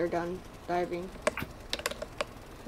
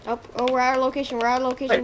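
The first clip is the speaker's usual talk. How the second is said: loud, fast alarmed call; alarmed shout; 2 semitones higher, 7 dB louder, faster than usual. Real, not acted. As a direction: raise the alarm, quick and loud. Alarm, boat off course.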